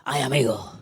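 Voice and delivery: low, breathy knowing "Ay, amigo"; a rueful aside to the congregation; knowing sigh. Acted. Preacher sighs at the congregation, knowing and rueful.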